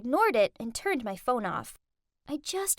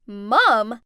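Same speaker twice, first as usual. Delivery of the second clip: loud, stunned "Mom?"; all three blurt it out in disbelief; shocked shout; 3 semitones lower, 10 dB louder than usual. Acted. Rich friends shocked that the maid is her mom, loud shout. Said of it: "Jasmine, Brent, and Sophia all shouted".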